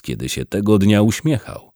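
usual speaking voice

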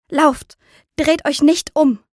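desperate mother calling out; urgent cry. A narrator's reading. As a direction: urge the children to flee. Mother urges children to flee.

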